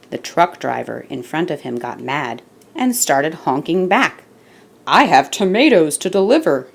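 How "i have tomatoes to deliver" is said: mock shout. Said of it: "he shouted".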